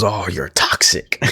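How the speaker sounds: whispered, slow, taunting; whispered taunt to Cardona; mock whisper. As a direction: breathe it out like a sneer. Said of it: "he goes".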